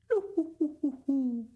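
high hooting bird laugh; loon's laugh. A narrator's reading. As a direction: hoot like a laughing loon. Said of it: "and the loon laughed".

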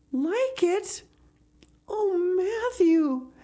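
put-on high, thrilled girl voice; overwhelmed Anne. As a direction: exclaim in delighted disbelief.

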